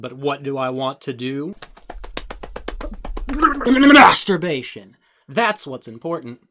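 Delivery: grandiose parody, rising to a yell; hammed-up lofty musing, then shouting; mocking impression. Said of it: "how typical".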